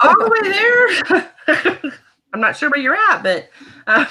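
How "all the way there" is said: high, slow laughing drawl; amused and stretched out, pitched high. Real, not acted. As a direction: draw it out through laughter. Joking about travelling to the show.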